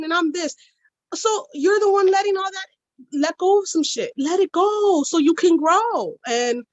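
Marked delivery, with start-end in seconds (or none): 1.1-6.2 rousing preachy push. Real, not acted. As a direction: build the exhortation up. Rousing call to let go.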